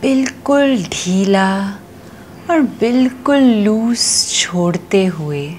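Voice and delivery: slow, soothing yoga nidra voice with long pauses; guided meditation. Acted. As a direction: stretch the key words and let each phrase settle. Leads yoga nidra relaxation.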